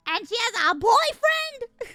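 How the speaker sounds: high, loud, slow mock outrage; hammed-up heartbreak; mock betrayal. To her co-host about Hello Kitty, mock heartbroken.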